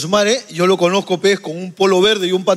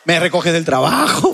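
mock sweetheart; 5 semitones lower, slower than usual. Teasing with a mock couple's line.

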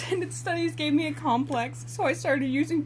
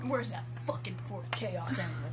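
a hint of low brooding hero, softer; lightly voiced Shadow; 5 semitones lower, 8 dB quieter than usual. Rewriting the line on request, low and soft. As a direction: mutter it low like the edgy hero. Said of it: "just say fuck".

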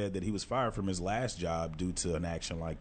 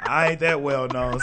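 ordinary talk, nothing marked

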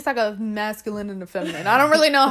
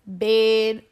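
country twang. Retold or imitated. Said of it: "the little twang in your accent".

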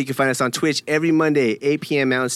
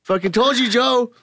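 ranting prophet; 11 semitones higher, slower than usual. Retold or imitated. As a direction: preach it like a doomsayer. Voicing Joe as a prophet.

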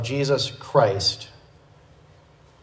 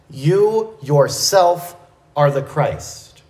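"You yourself" drawn out slowly and raised, then falling; stress on YOU YOURSELF; slower than usual. Acted. Preacher showing the Greek pronoun's force, emphatic "You yourself". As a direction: lean hard and slow on "You yourself". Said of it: "he puts that pronoun you at the very beginning, putting a major stress".

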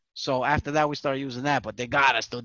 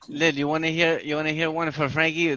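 ordinary talk

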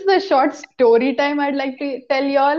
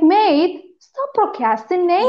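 higher-pitched, badly faked Australian accent; 5 semitones higher than usual. Podcaster quoting her bias, bad Australian accent. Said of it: "your Australian accent is so bad".

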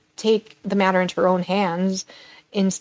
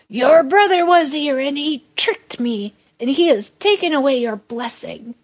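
a lightly voiced old father, raised; grieved old father; 5 semitones higher, 4 dB louder than usual. Isaac telling Esau about the trick, lightly voiced old father. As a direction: break the bad news gently but firmly.

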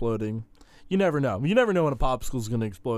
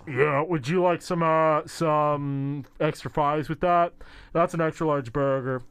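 put-on drive-through order voice, higher and halting; mock fast-food order-taker, drawn out; burger order-taker; 4 semitones higher, slower than usual. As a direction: play a burger worker cutting in over the radio. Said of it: "interference from the local Big Burger".

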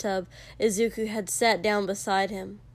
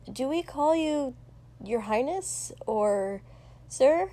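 higher-pitched, hesitant, rising questions; unsure question; 4 semitones higher than usual. A narrator's reading. Helper asking a prince how to address him, unsure. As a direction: feel out each title tentatively.

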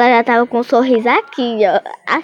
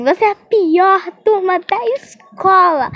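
mock scolding teacher; 7 semitones higher, slower than usual.

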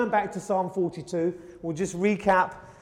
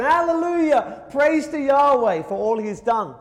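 high, exultant hallelujah; a procession leader's joyful shout; joyful praise; 6 semitones higher than usual. Retold or imitated. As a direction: lead the festival crowd in praise.

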